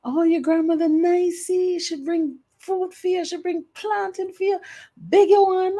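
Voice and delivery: very high mocking child voices; high-pitched singsong kids; teasing kids. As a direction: kids chanting over the plantain. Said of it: "they was like".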